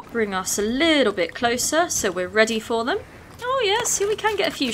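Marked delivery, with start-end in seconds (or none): 3.4-4.7 high and fast, pleased; bright excited rush, higher pitched; eager delight. Real, not acted. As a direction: spot the chance and jump on it. Sees an opening.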